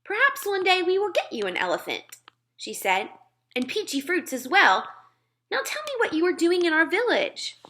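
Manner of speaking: put-on girl pressing her point; lightly voiced Sophie. Sophie arguing with the giant, lightly voiced girl.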